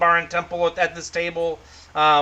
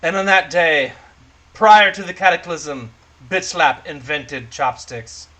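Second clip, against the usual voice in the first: loud storyteller opening, slowing at the end; mock-epic narration; 5 dB louder, slower than usual. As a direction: proclaim it as ancient legend. Mock-legend narration of the threat.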